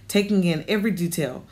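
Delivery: ordinary delivery, nothing marked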